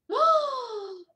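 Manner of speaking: high theatrical gasp; sharp high intake of breath, hammed up; mock excited gasp. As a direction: gasp like a starstruck fan. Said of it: "she'll go".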